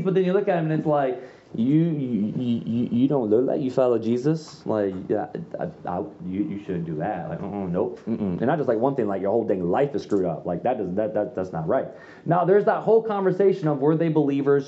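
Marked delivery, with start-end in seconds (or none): 1.5-11.9 mild mock scolding of a sinner; judgmental church critic. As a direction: lightly voice a preachy judge shaking their head.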